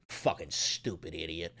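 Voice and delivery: low, quiet, scornful aside; muttered insult. Real, not acted. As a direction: mutter it under your breath with contempt. Scornful aside after mocking quote.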